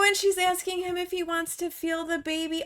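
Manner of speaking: ordinary delivery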